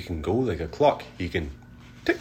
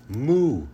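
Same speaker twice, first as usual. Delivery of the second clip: high loud mooing cow; 7 semitones higher, 6 dB louder than usual. A narrator's reading. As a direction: moo like a cow. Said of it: "He can go like a cow".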